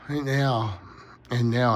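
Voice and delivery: real yawn pulling through the words; sleepy yawn stretching the words. Real, not acted. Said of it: "I can't stop yawning".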